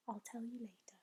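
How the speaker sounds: very soft, near whisper; hushed reply. A narrator's reading. Sophie putting off BFG's question, hushed murmur. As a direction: murmur it back quietly. Sophie answers under her breath.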